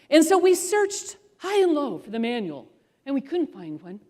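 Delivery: ordinary delivery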